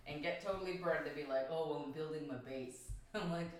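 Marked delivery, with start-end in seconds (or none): none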